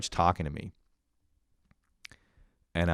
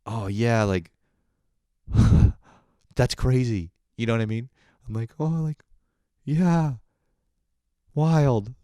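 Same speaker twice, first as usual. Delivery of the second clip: awkward reply; 4 semitones higher, 5 dB louder than usual. Retold or imitated. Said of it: "I think I'm just like".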